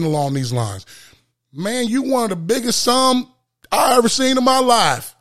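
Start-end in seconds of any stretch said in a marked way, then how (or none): 1.5-5.1 belligerent stranger. Retold or imitated. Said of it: "very belligerent".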